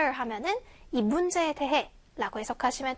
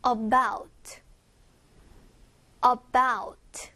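"About" repeated with the weight on -bout; light first syllable, strong second in "About"; stress on second syllable; 2 semitones lower, much slower than usual. Shown as a demonstration. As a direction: model "About" carefully, stressing its end. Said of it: "about의 강세가 있어요".

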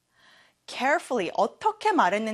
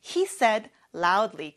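very slow, every word clear; slow model sentence; much slower than usual. Shown as a demonstration. Teacher showing students the sentence, very slow. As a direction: lay each word down for the class. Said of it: "loud하게 말을 했어요".

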